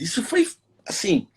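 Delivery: ordinary delivery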